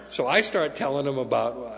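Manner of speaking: ordinary talk, nothing marked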